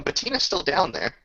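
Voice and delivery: normal talking voice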